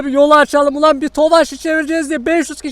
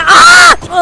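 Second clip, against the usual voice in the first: loud startled shout; shocked yell; 11 semitones higher, 8 dB louder than usual. Real, not acted. Truck hits car.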